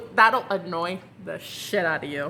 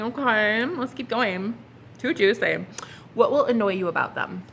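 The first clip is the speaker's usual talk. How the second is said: ordinary talk, nothing marked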